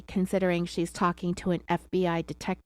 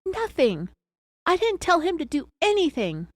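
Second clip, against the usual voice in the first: narrated shout; 8 semitones higher than usual. A narrator's reading. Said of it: "he shouted back".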